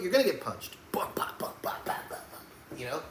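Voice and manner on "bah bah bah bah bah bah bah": voiceless rapid punch noises; soft percussive "bah" hits, fading away; imitated punch sounds. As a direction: mouth the thuds of landing punches.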